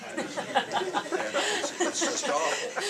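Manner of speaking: loud, high burst of laughter through the words; laughing. Real, not acted. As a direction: crack up at your own joke. Laughing at own joke.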